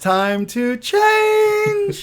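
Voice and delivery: put-on very high, slow cracking teen voice; Peter Brady voice crack. Co-host mocks his son's breaking voice, very high. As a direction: squeak it out like a voice breaking. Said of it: "Old school reference sounding like Peter Brady".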